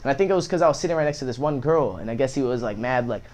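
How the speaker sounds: ordinary talk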